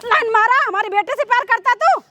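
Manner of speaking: outraged mother's tirade; scornful, indignant scolding; angry scolding. Acted. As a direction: berate him with indignant questions. Acted mother berates servant.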